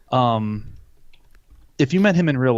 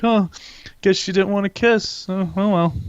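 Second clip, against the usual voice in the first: mock shrug; 7 semitones higher than usual. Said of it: "He's like".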